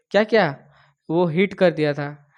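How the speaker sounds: ordinary talk, nothing marked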